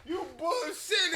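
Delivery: high, slow, laughing through it; imitating through laughter; laughing mock yell. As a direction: yell it while cracking up. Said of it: "he said".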